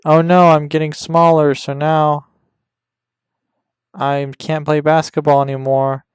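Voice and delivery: put-on a shrinking boy wailing about his game; mock panic. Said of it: "it's like".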